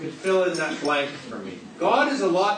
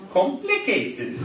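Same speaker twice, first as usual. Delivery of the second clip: slow, higher, playful mock Welsh accent; 6 semitones higher, slower than usual. Speaker teasing the congregation, mock Welsh accent. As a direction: stretch complicated out in a lilting Welsh accent, pitched up. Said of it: "Or as they say in the beautiful Welsh accent".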